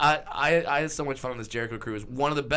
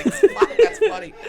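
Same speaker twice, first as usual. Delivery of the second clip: high laughing squeal; 10 dB louder than usual. Retold or imitated. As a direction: crack up mid-line. Laughing reaction imitated.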